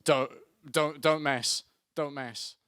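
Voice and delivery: loud threatening boss, half-acted; hammed-up furious manager; mock angry boss. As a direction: bark the threat at the worker. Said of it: "And they go".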